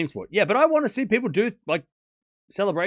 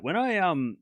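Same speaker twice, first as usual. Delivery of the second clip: usual speaking voice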